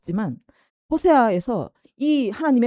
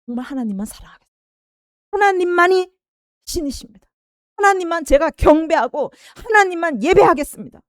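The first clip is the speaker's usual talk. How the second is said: higher, louder rising confession; fervent vow; 7 semitones higher, 5 dB louder than usual. Retold or imitated. Israelites confessing to God after the miracles, fervent and rising. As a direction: swear devotion with growing fervor.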